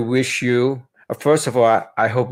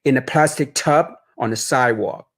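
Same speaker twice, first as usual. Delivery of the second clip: story narration; 2 semitones higher than usual. A narrator's reading. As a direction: read it gently like a bedtime story. Author reads picture book aloud.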